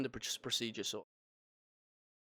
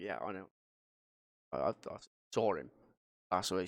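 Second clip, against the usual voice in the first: numb, hushed reply; 5 semitones lower than usual. Retold or imitated. Telling paramedics he saw death.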